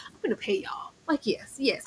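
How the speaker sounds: usual speaking voice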